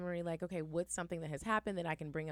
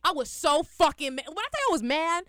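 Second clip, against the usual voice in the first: loud, heated retelling; real anger; 9 semitones higher, 10 dB louder than usual. Real, not acted. Ranting to friends about the pyramid scheme, loud and heated. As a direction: let the fury spill out. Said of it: "You sound mad right now".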